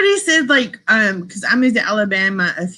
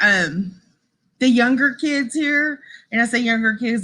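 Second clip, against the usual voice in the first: ordinary talk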